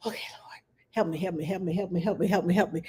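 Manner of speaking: whispered plea for help; whispered prayer. Retold or imitated. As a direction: beg the Lord under your breath. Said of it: "So I'm sitting here like".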